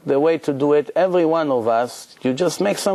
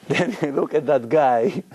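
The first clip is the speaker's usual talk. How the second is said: ordinary talk, nothing marked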